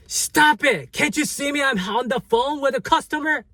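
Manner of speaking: high-pitched, fed-up yell at the boss; yelling back. Retold or imitated. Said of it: "uh, full force".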